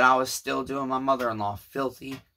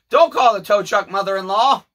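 higher, louder pleading call; a raised, earnest plea; 8 semitones higher, 9 dB louder than usual. Retold or imitated. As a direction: plead loudly to the mother-in-law. Voicing Rob's plea.

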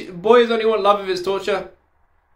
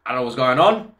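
ordinary talk

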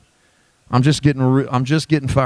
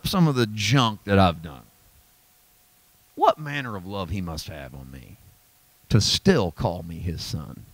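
much softer, slow, lower marvel; humbled awe; 4 semitones lower, 4 dB quieter, slower than usual. Retold or imitated. John humbled by grace, speaking soft and slow. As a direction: sink into grateful wonder. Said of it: "I hear him go".

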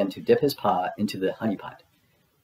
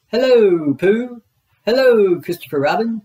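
high, loud, boisterous tiger voice; bouncy Tigger; 11 semitones higher, 6 dB louder than usual. Acted. Tigger bouncing past, calling loudly to his friends. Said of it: "Tigger called".

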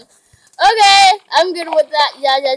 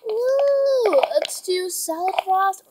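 usual speaking voice

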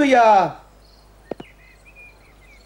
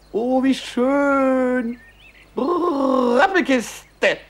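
savoring words; 3 semitones higher, slower than usual. Acted. Mouth savoring beautiful words.

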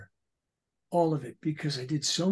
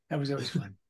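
ordinary delivery

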